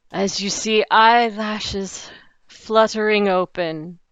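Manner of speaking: ordinary talk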